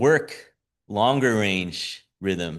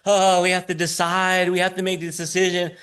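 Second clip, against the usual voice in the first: mock fretting; 6 semitones higher than usual. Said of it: "They're like".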